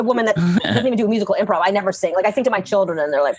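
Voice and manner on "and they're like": ordinary delivery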